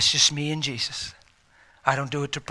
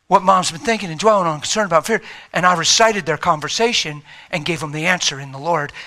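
ordinary talk

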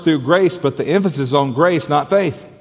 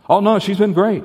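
quick eager reply; 7 semitones higher than usual. Acted. Man brushing off the question.

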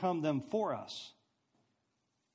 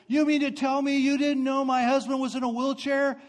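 higher-pitched imitation of an outraged wife; indignant yell; 7 semitones higher, 3 dB louder than usual. Angry wife accusing a passenger on a packed plane, mock yell. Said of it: "she yells back to me".

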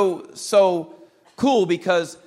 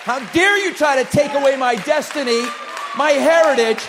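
louder, higher preaching shout at the enemy; defiant declaration; 5 semitones higher, 5 dB louder than usual. Acted. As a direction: rise and shout your stand against the devil.

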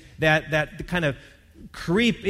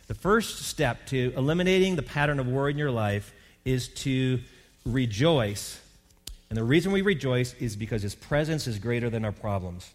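ordinary talk, nothing marked

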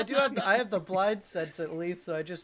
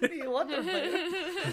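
laughing; 9 semitones higher, slower than usual. Real, not acted. Laughing repeat of the joke.

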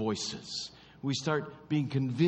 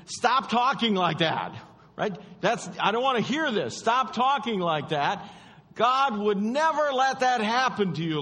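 loud, higher protest; Peter's rebuke; 8 semitones higher, 5 dB louder than usual. Acted. Peter rebuking Jesus, a loud high protest. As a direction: push back hard at Jesus.

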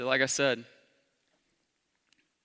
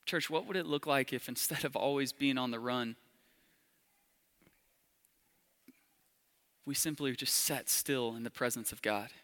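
hushed altar call; 4 dB quieter than usual. Acted. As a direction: draw them in gently and quietly. Soft, slow appeal to the congregation.

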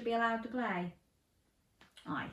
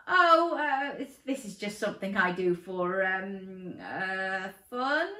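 trembling voice; 5 dB louder, slower than usual. Acted. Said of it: "Dinosaur Dan's voice trembled".

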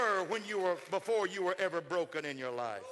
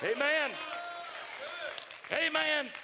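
shouted amen; 9 semitones higher, slower than usual. Real, not acted. Preacher shouting amen after his climax. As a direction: call it out to the room.

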